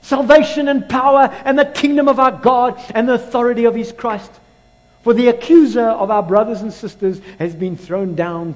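high, ringing proclamation; forceful shouted scripture, easing slightly toward the end; loud voice from heaven. Acted. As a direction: proclaim it loudly across the heavens. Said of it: "he hears this loud voice".

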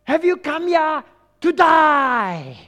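slow, high parade-ground bellow; sergeant's shout. Retold or imitated. Sergeant major challenges new troops, long loud bellow. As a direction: bellow it, drawing out the last word. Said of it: "he shouts to everybody".

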